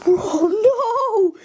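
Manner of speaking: laughing shriek of dismay; laughing cry. Real, not acted.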